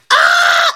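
high comic bawl; shrieked sob, played for laughs; mock crying wail. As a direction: burst into a loud, hammed-up wail. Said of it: "She starts crying down there".

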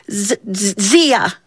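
stuttering with cold, stuck on the Z; acted chattering stammer; shivering. A narrator's reading. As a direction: shiver through the name. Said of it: "he began to shiver".

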